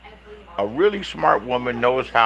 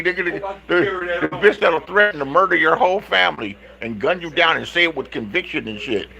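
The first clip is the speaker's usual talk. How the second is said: normal talking voice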